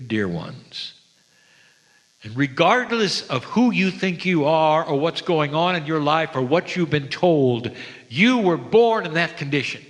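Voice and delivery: ordinary delivery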